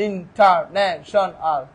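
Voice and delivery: "International" slow, in five separate beats; syllable by syllable. Shown as a demonstration. Teacher repeating the count, "international" slowly split. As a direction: break "international" into in, tar, na, shon, al. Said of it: "অনেকগুলো হইতে পারে".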